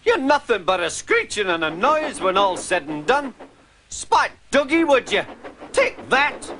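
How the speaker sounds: high, heated scolding; angry telling-off. A narrator's reading. Engine scolding the noisy van, high angry rant. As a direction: give the van a piece of your mind. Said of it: "Donald burst out".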